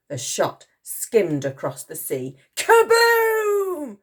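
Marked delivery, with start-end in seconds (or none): 2.6-4.0 high, loud drawn-out kaboom; explosive boom. A narrator's reading. Reader sounding the exploding barrel, explosive. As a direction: burst it out like an explosion.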